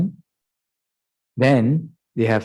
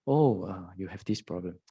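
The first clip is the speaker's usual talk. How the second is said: soft, gentle pointing out; a hint of a gentle teacher's voice; quiet teacher remark; 3 semitones lower, 11 dB quieter than usual. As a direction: say it softly like a kind teacher. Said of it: "the teacher tell you that".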